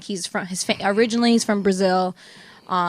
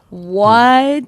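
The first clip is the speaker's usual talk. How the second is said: loud, drawn-out what; retold disbelief; 7 dB louder than usual. Friends react to his gushing email, loud disbelief. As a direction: stretch it out, incredulous.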